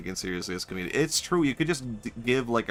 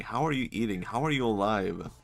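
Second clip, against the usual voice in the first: ordinary delivery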